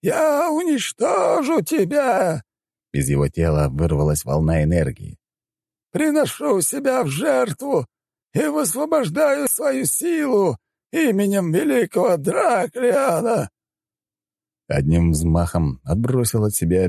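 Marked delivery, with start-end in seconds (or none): 0.0-2.4 very high, drawn-out battle cry; enraged, strained yell; furious roar. A narrator's reading. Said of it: "яростно взревев". 5.9-13.5 very high, slow, strained proclamation; stretched, ringing ritual shout; fanatical incantation. Acted. Elder cries out sacrificial incantation.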